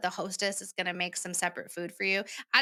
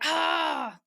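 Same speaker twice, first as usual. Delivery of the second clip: a sharp, exasperated "ugh" at a higher pitch; frustrated groan; 8 semitones higher, 4 dB louder than usual. Retold or imitated. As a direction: let out a fed-up groan. Frustrated groan quoting herself.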